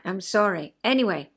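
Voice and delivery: ordinary talk, nothing marked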